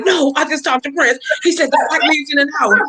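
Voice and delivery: can't believe it, insisting; excited news. Retold or imitated. Girl telling her doubting mom, high excited insistence.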